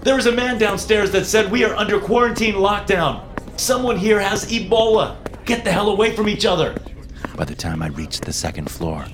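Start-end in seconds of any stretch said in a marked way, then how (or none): 0.0-6.8 high, urgent frantic warning; panicked shouting. A narrator's reading. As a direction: shout it up the stairwell. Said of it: "I began to scream to the people upstairs".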